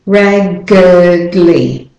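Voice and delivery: "raggedly" stretched through g, d and l; slow sound joins. Shown as a demonstration. Teacher joining G to D to L, very slow "raggedly". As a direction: draw "raggedly" out as RAG-guhd-lee. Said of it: "you're going from G".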